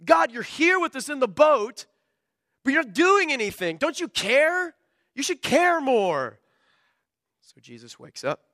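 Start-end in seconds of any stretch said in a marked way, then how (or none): 0.0-6.4 much higher, pleading reproach; frightened disciples complaining. Retold or imitated. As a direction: whine at a sleeping Jesus, higher and higher. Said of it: "they go and they say".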